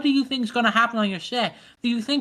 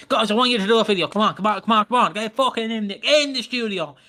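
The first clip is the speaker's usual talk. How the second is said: rally them into the studio at pace; urgent studio boss firing up the cast; pushy, hyped-up demand; faster than usual. Shown as a demonstration.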